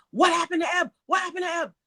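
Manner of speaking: high-pitched imitated yell; screaming. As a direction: imitate a panicked brother-in-law yelling in the background. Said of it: "Dale, was screaming".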